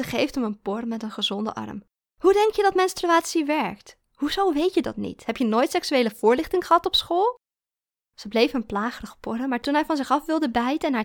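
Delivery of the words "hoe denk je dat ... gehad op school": louder, higher string of questions; incredulous, teasing rebuke; scoffing disbelief. A narrator's reading. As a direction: needle him about his ignorance. Girlfriend mocks his ignorance.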